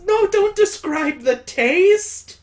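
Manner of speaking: loud, high plea of revulsion; disgusted protest. Real, not acted. Reader begging the text not to describe taste, disgusted. Reader refuses to hear more.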